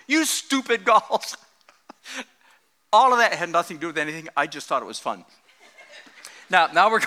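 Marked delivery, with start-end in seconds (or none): 0.1-1.4 "You stupid Gauls" drawn out slowly, each word weighed; slow mock insult. Preacher quoting Paul's insult to the Galatians, slow and relished. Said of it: "He said".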